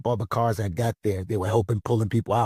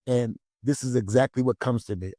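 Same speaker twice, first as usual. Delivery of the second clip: ordinary talk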